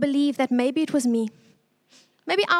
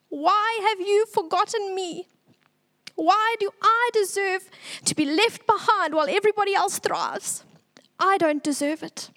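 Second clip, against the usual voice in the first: higher, pleading and raw; anguished cry to God; 6 semitones higher than usual. Retold or imitated. Retelling her prayer in pain, a higher anguished plea. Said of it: "I screamed and I cried".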